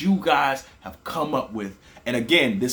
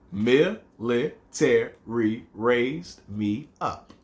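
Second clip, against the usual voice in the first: "Military raised me up" slow and counted; syllable counting; much slower than usual. Shown as a demonstration. Teacher counting seven syllables for students. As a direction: tick off each syllable slowly. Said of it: "That's seven".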